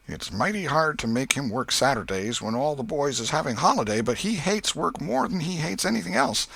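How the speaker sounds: put-on old aunt grumbling fondly about her boy; Aunt Polly. A narrator's reading. Aunt Polly's monologue continues.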